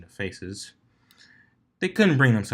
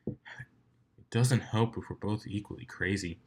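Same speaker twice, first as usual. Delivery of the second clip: deadpan; 5 dB quieter, slower than usual. Acted. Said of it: "deadpanned Soren".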